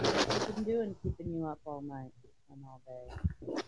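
distant, muffled, through a wall; far away. Real, not acted. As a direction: talk from far off the mic. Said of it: "why do you sound so far away".

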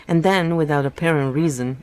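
steady read-aloud novel narration; story narration. A narrator's reading. Reader narrating a novel chapter aloud, in storytelling voice. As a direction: read the book to your listeners, evenly.

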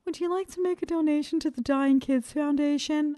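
high, sweet collector's pitch asking for money; charity collector voice. Retold or imitated. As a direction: put on a chirpy fundraiser voice. Mimics a charity collector's ask.

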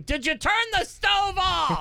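high shouted nagging question; yelling dad. Pretend.

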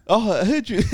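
high, sweet puppy voice. Acted. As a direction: coo it like greeting a puppy. Continues the puppy voice.

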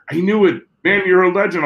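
high, eager gush of recognition; excited fan. Retold or imitated. Starstruck fan recognizes him.

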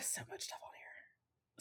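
whispered aside to herself while scrolling. Real, not acted. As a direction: drop to a breathy whisper, talking to herself.

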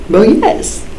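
bright, eager yes. Retold or imitated. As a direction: jump at the offer. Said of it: "And I was like".

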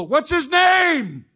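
slow, high, booming call-out; rally the congregation with a big slow shout; shouted call to the crowd. Retold or imitated. Preacher calling out to the congregation, slow booming shout. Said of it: "I go".

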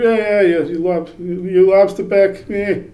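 high, loud playground jeer; mock taunting. Visitor in England tossing around an old slur, mock taunting.

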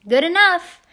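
high, very loud, happy agreement; big rising holler; yelled. A narrator's reading. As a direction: yell it right into someone's face. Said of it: "he yelled into my face".

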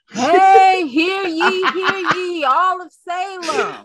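loud, high town crier shout. Pretend. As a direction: call it out to the town square, laughing. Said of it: "he's out in the square".

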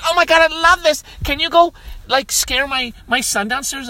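high, loud gushing at the start; mock thrilled mom. Friend's mom asks a favor, mock high gushing. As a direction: gush like an excited mom. Retold mom loves the costume.

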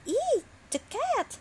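very high squeal of fright; frightened gulp. A narrator's reading. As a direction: squeak in terror at the cat. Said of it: "gulped Gus".

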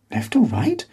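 high rising puzzled repeat; puzzled question. A narrator's reading. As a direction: echo it back, baffled.

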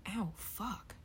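quiet pained yelp after a bump. Real, not acted. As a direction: wince out a hushed curse. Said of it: "I hit my nose".

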